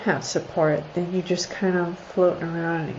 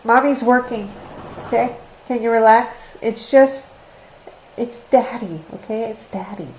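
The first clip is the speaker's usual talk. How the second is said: mom calming dogs; 3 semitones higher, 5 dB louder than usual. Real, not acted. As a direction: talk the dogs down over their barking. Calming barking dogs, talking to them.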